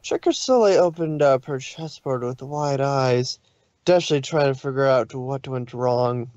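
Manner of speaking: ordinary delivery, nothing marked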